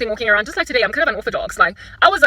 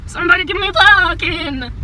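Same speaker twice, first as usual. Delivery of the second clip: high pleading whine; 8 semitones higher, 6 dB louder than usual. Real, not acted. Driver begging for a parking spot, high whine.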